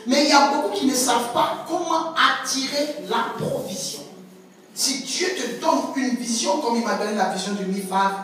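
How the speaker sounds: ordinary talk, nothing marked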